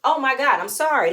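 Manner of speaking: outraged outburst. Real, not acted. Vlogger reacting to the husband, loud exasperated outburst. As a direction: blurt it out, scandalized.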